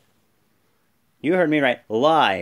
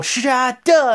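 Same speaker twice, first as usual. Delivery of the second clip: lights-out cry; 10 semitones higher, 5 dB louder than usual. Retold or imitated. Wyatt lights-out effect again.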